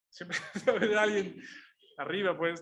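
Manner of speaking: amused laugh as he repeats the thought. Real, not acted. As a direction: laugh through "Siempre hay alguien arriba".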